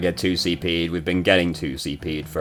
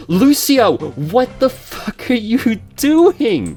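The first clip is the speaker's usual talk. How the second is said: high, drawn-out disbelief; incredulous teammates; 4 dB louder, slower than usual. Retold or imitated. Voicing the team yelling at Lucio, high incredulous. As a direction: sound like the whole team can't believe it. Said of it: "And they're like".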